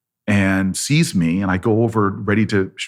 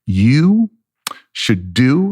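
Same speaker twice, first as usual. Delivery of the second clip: momentous pronouncement; 3 semitones higher, 4 dB louder, much slower than usual. Retold or imitated. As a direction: hold each piece back like wisdom from heaven. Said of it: "as if she's about to tell me words from angels".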